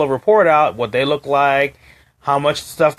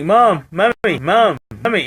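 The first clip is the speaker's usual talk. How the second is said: lightly voiced whiny cartoon baby nagging; a hint of Stewie calling for his mom over and over; understated nagging cartoon kid voice; Stewie whining; 3 semitones higher, slower than usual. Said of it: "it was like Stewie on Family Guy".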